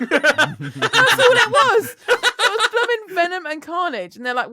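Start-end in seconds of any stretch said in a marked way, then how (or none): 0.0-2.0 words squeezed out through laughter; slow helpless laughing. Real, not acted. Laughing at her own story. 2.1-4.2 high, laughing disbelief; amused exasperation. Real, not acted. Laughing retelling absurd moment.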